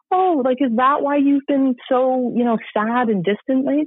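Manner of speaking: a hint of an amused, loving mom; gently playing the mom's puzzled answer; mom's reply.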